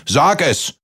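high, sharp storybook bellow; bellowed order. A narrator's reading. Giant from above to Kaspar, bellowing. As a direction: jump up in pitch and demand an answer. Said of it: "brüllte der Riese von oben".

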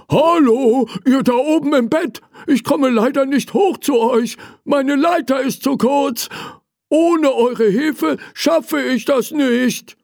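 very high little toy voice calling up; toy fireman calling. Acted. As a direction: call for help from below in a squeaky-high voice. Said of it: "Er rief". (hohe Spielzeugstimme, um Hilfe rufend)

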